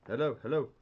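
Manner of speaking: usual speaking voice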